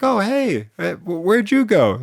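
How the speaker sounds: high, startled hello; startled to find someone still there; host surprised the guest never left, high startled greeting. Retold or imitated.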